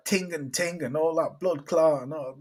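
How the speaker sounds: imitating a Londoner putting on Jamaican patois; mocking Jamaican patois. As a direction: ape her London-tinged Jamaican patois. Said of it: "the London accent in her patois".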